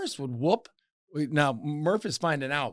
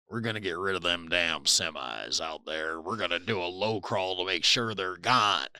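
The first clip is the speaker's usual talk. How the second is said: a much lower, quieter voice for the guys; low mock voice for the coworkers; coworkers' reply; 11 semitones lower, 5 dB quieter than usual. Coworkers answering, voiced by teller.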